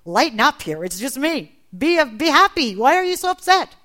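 jolly the frightened friends along; lightly voiced Jesus. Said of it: "And he said, "Hey, you guys".

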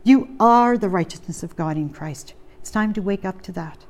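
usual speaking voice

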